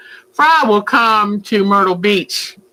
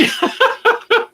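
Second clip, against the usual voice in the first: high-pitched burst of laughter; high squealing laugh; 14 semitones higher than usual. Real, not acted. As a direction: laugh up high at the rude bird. Laugh at bird.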